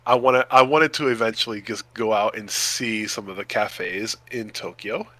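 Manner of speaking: ordinary delivery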